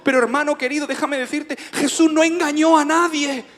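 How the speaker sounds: loud, high sermon delivery; preaching. Real, not acted. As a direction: proclaim it to the congregation, drawing out key words. Preaches at full force. (predicación enérgica, voz alta y aguda)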